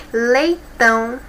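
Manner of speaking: "Leitão" drawn out, "tão" stressed; slow stressed demo. Shown as a demonstration. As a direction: say "Leitão" slowly, landing on "tão". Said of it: "a última sílaba que é mais forte". ("Leitão" devagar, com força no "tão")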